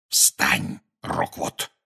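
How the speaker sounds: cold, unvoiced whisper. A narrator's reading. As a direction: hiss the command softly. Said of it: "шепнул Гарри". (холодный шёпот)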